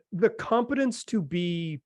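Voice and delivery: normal talking voice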